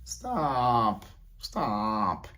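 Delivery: coy fading stop; mock protest. Imitating a woman saying stop, playfully fading. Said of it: "heard females say".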